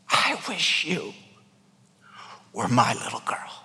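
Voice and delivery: teacher whispering kindly to Mary; gentle whisper. Retold or imitated. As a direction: whisper it tenderly to a child. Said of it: "did the whisper test".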